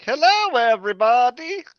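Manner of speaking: very high, loud, sing-song cartoon greeting; Doctor Hines as a goofy cartoon doctor. Ship's doctor bursts in greeting the crew, high cartoon voice. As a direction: belt it out like a Simpsons doctor. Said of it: "He's a Simpsons character".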